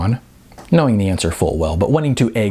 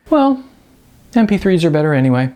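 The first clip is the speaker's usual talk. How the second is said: quick teasing jab, pitched higher; Sean baiting; 9 semitones higher, 4 dB louder, faster than usual. A narrator's reading. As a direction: toss the bait lightly and fast. Sean baits Jose.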